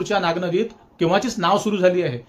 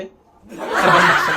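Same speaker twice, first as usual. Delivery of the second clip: laughing insult; 5 dB louder, much slower than usual. Acted. Mock-angry rant at critics.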